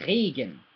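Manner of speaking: "Regen" as RAY-gen with throat R; German pronunciation. Shown as a demonstration. Teacher prompting learner to copy, German RAY-gen for "Regen". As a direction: uvular R opening "Regen". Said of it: "Try and say that".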